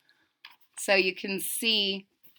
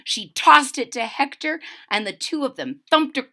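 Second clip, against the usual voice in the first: ordinary delivery, nothing marked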